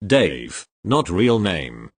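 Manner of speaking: plain reading voice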